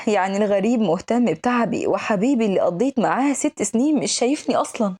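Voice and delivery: usual speaking voice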